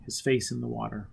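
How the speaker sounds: usual speaking voice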